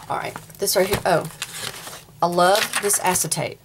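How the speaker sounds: ordinary delivery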